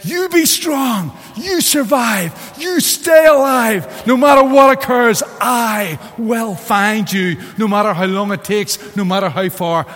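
slow, high, shouted vow, fading at the end; impassioned shout. Retold or imitated. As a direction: yell the promise across the roar of the waterfall. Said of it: "Daniel Day-Lewis says".

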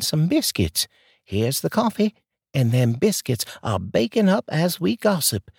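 high, folksy Southern neighbor voice; a chatty Southern lady offering coffee; sweet southern drawl. Acted. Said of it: "that sweet southern drawl".

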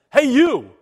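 loud brash New Jersey "Hey, you!"; in-your-face New Jersey holler; New Jersey callout. Pretend. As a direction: yell it like a New Jersey street guy. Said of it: "This is, this is pure New Jersey here".

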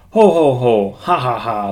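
loud ho ho ha ha laugh. A narrator's reading. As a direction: laugh mockingly at your brother. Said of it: "laughed the two lazy little pigs".

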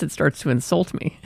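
usual speaking voice

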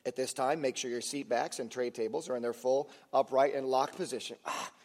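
low, even cabin announcement voice; flight attendant. Retold or imitated. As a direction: read it like a routine in-flight announcement. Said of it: "I hear the speech from the flight attendant".

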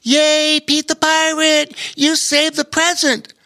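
high, loud cheering voice; cheering woman. A narrator's reading. Tall woman cheering Pete for saving the present, high and loud. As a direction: call out in delight. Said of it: "a tall woman called out".

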